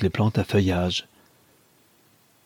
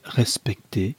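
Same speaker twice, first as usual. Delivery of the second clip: ordinary delivery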